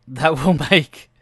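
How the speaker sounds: ordinary delivery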